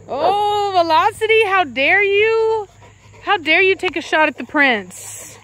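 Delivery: high, drawn-out playful scolding of a pet; mock outrage. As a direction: act scandalized at your dog. Mock-scolds a dog for nipping.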